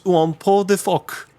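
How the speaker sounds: French label read aloud. Real, not acted. Podcaster reads a beer label all in French, French pronunciation. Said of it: "this is all in French".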